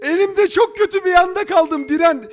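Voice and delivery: high, loud panicked yelling; panicked shouting. Real, not acted. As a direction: yell at the bomb in panic. Bomb timer about to blow.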